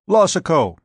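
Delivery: sharp, loud call of a name. A narrator's reading. Doctor calls out to the sleeping patient, sharp and loud. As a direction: snap the name out to wake him. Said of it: "Court called out sharply".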